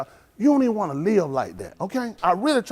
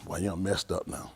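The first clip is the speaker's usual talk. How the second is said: mock menace; 13 semitones lower, 11 dB quieter, slower than usual. Taunting the cop quietly.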